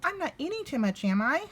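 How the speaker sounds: high, quick cub question; a little worried cub checking, fast and high; little bear voice. Acted. Little Bear asking Grandmother if he eats too much, quick high voice.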